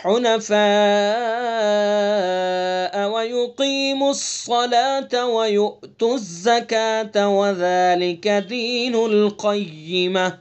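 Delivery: slow melodic tajweed chant; measured chanted recitation; Quran recitation. Shown as a demonstration. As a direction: recite the whole verse in long drawn vowels. Full verse recitation.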